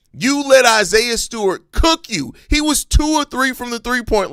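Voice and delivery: slow and heated, stressing let; exasperated rant. Real, not acted. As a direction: hammer it home in disbelief. Repeats the complaint, heated.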